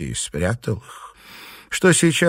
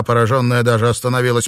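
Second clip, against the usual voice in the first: ordinary talk, nothing marked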